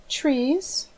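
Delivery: put-on a small child answering shyly; child's reply.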